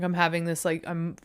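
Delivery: ordinary talk, nothing marked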